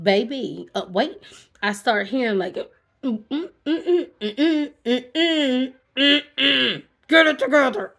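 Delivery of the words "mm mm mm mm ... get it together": higher, choked little hums before the words; imitated whimpering. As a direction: mimic your own stifled crying. Hearing herself whimper.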